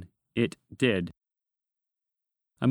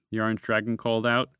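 plain reading voice

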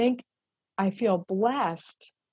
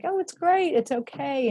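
forced cheer; 7 semitones higher than usual. Retold or imitated. Forcing cheer as executive director.

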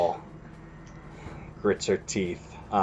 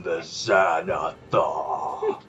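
slow, lower, booming villain proclamation; booming arrogant alien overlord; 8 semitones lower, much slower than usual. Acted. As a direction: announce yourself like a tiny tyrant. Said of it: "it shouts in a booming voice".

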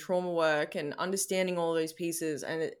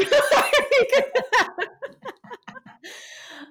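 loud, high-pitched burst of laughter; real laugh, pitched way up and loud; big high laugh; 6 dB louder than usual. Real, not acted. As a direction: crack up at yourself. Laughing after her own rant.

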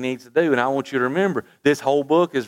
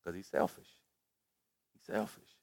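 dropped almost to a murmur, much softer; hushed verdict; 5 semitones lower, 15 dB quieter than usual. Real, not acted. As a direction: say it low and heavy, like a sad verdict. Preacher drops voice for judgement.

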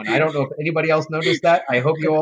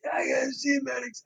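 put-on high, much softer, strangled voice of a kid who can barely speak; strained sick kid; 10 semitones higher, 9 dB quieter than usual. Kid with a swollen neck begging his boss to see the medics, high and strangled. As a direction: squeeze the words out through a swollen throat. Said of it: "this is the way he's talking".

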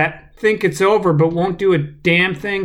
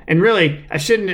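usual speaking voice

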